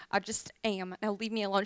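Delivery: low, sullen brush-off; grumpy mule. Acted. Mule brushes off the old lady's question, grumpy and low. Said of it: "Why are you so grumpy".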